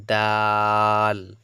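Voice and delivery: দাল with a very long loud "aa"; drawn-out vowel. Shown as a demonstration. Teacher models for students, দাল drawn out long. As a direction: hold the vowel of দাল steady. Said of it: "এগুলো একটু করে টানবেন".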